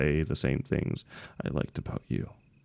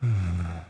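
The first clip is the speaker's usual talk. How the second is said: long shaky sigh. Acted. Being hugged by the friend.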